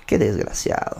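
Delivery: breathy whispered jab under the breath; imitated whisper of disgust; whispered aside. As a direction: hiss it like a private insult. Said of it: "era como que".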